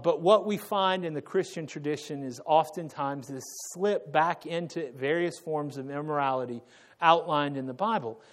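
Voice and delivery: normal talking voice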